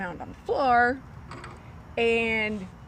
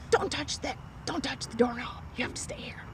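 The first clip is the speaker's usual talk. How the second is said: imitated whispery little-girl command; soft, whispered mock warning; child's hushed order; 2 semitones higher, 6 dB quieter than usual. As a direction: whisper it as a bossy kid would. Child forbids her leaving, acted out.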